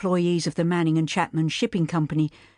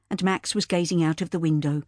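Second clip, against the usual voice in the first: ordinary delivery, nothing marked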